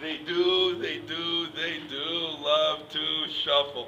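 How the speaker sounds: slow, stretched repetition on a level pitch; drawn-out agreement. Pretend. Comic agreeing with his friend about shuffling, slowly drawn out. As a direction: draw the words out, lingering on each.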